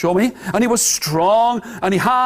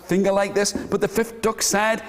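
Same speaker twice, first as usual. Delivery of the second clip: usual speaking voice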